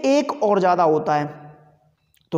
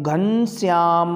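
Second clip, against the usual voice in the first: slow "Ghanshyam" with a long-held final vowel; drawn-out calling of "Ghanshyam"; calling someone, prolonged; 3 semitones lower, much slower than usual. Shown as a demonstration. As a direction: call "Ghanshyam", stretching out the ending. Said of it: "जैसे हम किसी को पुकार रहे हैं".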